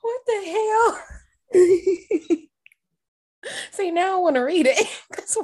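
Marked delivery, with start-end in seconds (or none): 0.0-2.4 laughing shock. Real, not acted. Shocked by bar mitzvah news.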